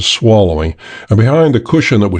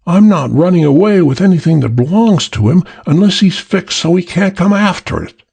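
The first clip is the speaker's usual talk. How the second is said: higher voiced woman bargaining; cool voice of the woman, pitched up; woman's voice; 8 semitones higher than usual. The girl bargaining coolly, a higher woman's voice.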